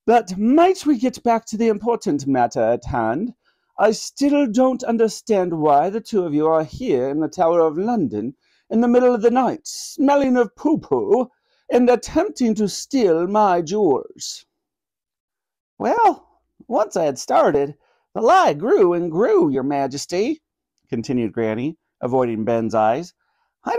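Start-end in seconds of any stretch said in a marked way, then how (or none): 0.0-14.5 higher-pitched, regal and clipped; an imperious monarch demanding an explanation; clipped Queen. A narrator's reading. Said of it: "said the Queen in her clipped tones". 15.8-20.4 high-pitched granny voice, dropping at the end; sheepish old grandmother owning up; apologetic granny. Acted. Granny confessing her lie to the Queen, sheepishly.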